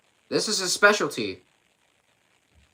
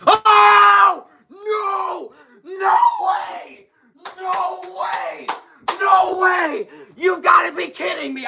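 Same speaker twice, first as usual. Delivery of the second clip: high, loud disbelieving shouts; shocked shouting; 4 dB louder, much slower than usual. Real, not acted. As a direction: scream it in total disbelief. Commentator sees shock backflip.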